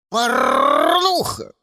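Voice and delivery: slow, low squawk; cawing crow yell. A narrator's reading. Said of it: "заорал он".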